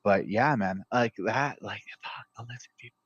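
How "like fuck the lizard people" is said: very soft, voiceless whisper; whispered aside. Real, not acted. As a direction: whisper it under your breath. Hushed aside about lizard people.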